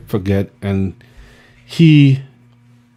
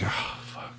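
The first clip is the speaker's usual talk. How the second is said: breathless whispered curse; whispered dread; 21 dB quieter than usual. Retold or imitated. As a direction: whisper it under your breath. Muttered dread after being told off.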